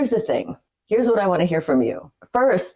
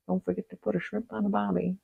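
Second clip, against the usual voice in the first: softer, strained Aussie impression; failed Australian accent; 3 semitones higher, 8 dB quieter than usual. Shown as a demonstration. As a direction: force an Australian accent and miss. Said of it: "No, that's very, never mind".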